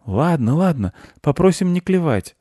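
put-on high, reassuring boy's voice; high-pitched young boy soothing; little boy. A narrator's reading. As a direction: give in with a high child voice. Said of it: "сказал мальчик".

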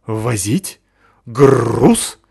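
eager dog voice, loud on the end; growling dog voice. Acted. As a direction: ask it like a keen big dog. Dog voice asks. (рычащий собачий голос)

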